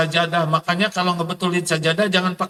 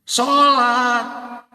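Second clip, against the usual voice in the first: "Sholat." said high and loud as a punchline; emphatic answer; 8 semitones higher, 3 dB louder than usual. Real, not acted. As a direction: fire back "Sholat" with a raised voice.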